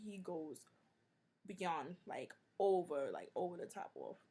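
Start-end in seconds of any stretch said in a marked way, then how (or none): none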